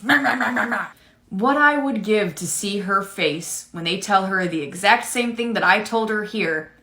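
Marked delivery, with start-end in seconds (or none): 0.0-0.9 mocking meh meh. Mocking the customer.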